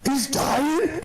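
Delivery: high, rising squeaky dog voice; mock dog voice. Dog watching its owner get attacked, high mock alarm.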